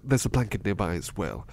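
usual speaking voice

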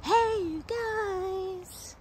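high, long-drawn holler; film catchphrase call. Acted. Fan quoting the film at its location, drawn-out call. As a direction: stretch each word out high like a call.

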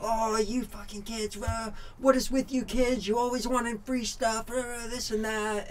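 retold tirade, pitched well up and dragged out; mock angry rant. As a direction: snap at the kids like a fed-up pro racer. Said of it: "absolutely just flipped out on me. Pissed off".

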